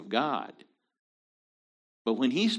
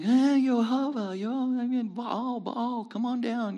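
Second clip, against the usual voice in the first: mocking pagan cry; 12 semitones higher than usual. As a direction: ham up the prophets begging their god. Preacher mocks Baal prophets calling.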